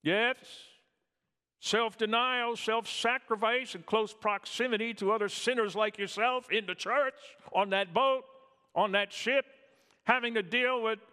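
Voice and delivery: high, loud preaching cry; fiery preaching. Acted. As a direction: preach it out at full force. Preaching at full force.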